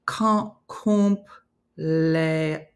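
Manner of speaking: very slow, broken syllables; halting, drawn-out attempt at cumpleaños; sounding it out. Shown as a demonstration. Sounding out a Spanish word.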